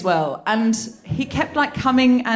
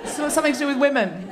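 laughing quote. Retold or imitated. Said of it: "I said to someone".

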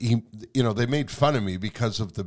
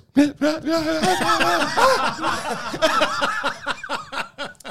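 high choked sob-laugh; sobbing snort imitation; 3 dB louder than usual. Shown as a demonstration. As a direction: demonstrate a stifled sob snort. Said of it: "you do this".

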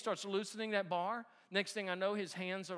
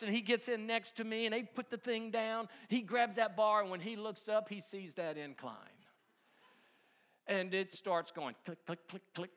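ordinary talk, nothing marked

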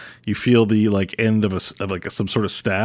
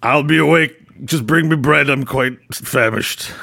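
put-on haughty old wizard; 5 semitones higher than usual. [name] drinking.

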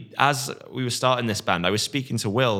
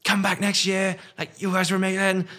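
high, excited gushing fan voice; mock starstruck fan; 8 semitones higher than usual. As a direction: imitate a thrilled local fan begging you back. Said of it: "people in Swindon were like".

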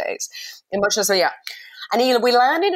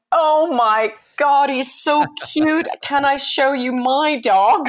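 high, slow, gushing over the cute dog; besotted officer melting over a puppy; 5 semitones higher, slower than usual. Retold or imitated. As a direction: coo like a smitten border guard. Passport officer cooing over dog.

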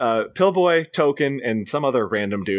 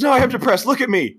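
higher, louder whiny insisting; whiny mock protest; 13 semitones higher, 4 dB louder than usual. Poser insists to his friend, higher whiny protest. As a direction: plead like a sulky teen. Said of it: "see my impression of what he does".

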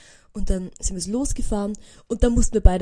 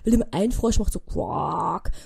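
normal talking voice

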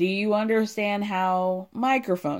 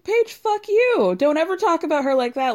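mock furious; 8 semitones higher, 4 dB louder than usual. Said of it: "And Danielle says".

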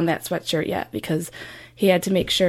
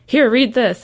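louder, pushy "Here, read this." with a falling end; insistent grandpa; 4 semitones higher, 6 dB louder than usual. Retold or imitated. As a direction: shove "Here, read this." at them over dinner. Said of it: "he's persistent".

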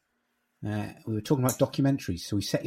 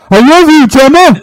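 shouting much higher and louder; loud declaration; 21 dB louder than usual. Pretend. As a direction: bellow a love declaration. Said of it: "and go".

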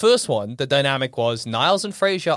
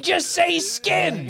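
gruff dad voice; 12 semitones higher, much slower than usual. Pretend. Acting Frasier's dad.

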